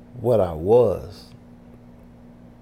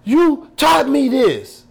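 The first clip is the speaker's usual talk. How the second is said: slow, loud, high-pitched blame; acted heated accusation, drawn out; angry accusation, re-enacted; 9 dB louder, slower than usual. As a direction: hurl it at him word by word. Associate's accusation, retold.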